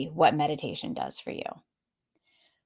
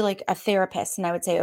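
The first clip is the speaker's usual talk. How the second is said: ordinary delivery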